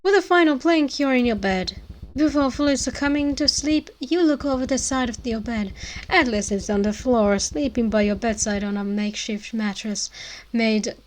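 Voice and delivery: ordinary delivery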